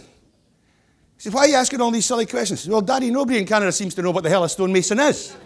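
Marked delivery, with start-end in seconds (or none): 1.3-2.6 much higher, exasperated dad voice; a baffled dad, pitched up; exasperated father. Acted. Father in joke. 2.7-5.3 put-on higher, louder whiny child; complaining child. Punchline of joke.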